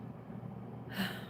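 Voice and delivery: long breathy sigh; tired sigh. Real, not acted. Tired, winding down the stream.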